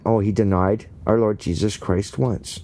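ordinary delivery

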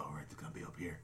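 low, hushed TV-hunter voice; hunter's whisper. Retold or imitated. Hunters crouched in a clearing whispering, hushed hunter's voice. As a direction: whisper it like a hunter stalking prey. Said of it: "they do the whole hunter's voice".

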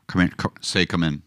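lightly sketched Scottish film-star voice; Sean Connery. Acted. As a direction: try it low and Scottish. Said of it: "as Sean Connery".

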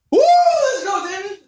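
high, loud whooping cheer; excited cheering. Real, not acted. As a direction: hype him up, shout it. Cheering a big wing bite.

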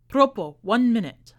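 loud call across the stage. A narrator's reading. Magician calls out across stage.

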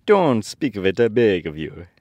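lightly acted, loud protest from a woodman; a touch of grand, falling protest; modest woodman. A narrator's reading. Woodman waving off the mouse's thanks, lightly acted modest protest. Woodman voiced in story reading.

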